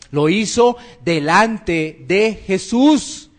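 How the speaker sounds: normal talking voice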